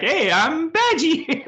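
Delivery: very high, slow, cartoonish greeting; sing-song it like a cheesy assistant; chirpy hologram. Badgie the hologram greeting someone, high and sing-song.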